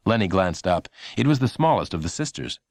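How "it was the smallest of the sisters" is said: ordinary delivery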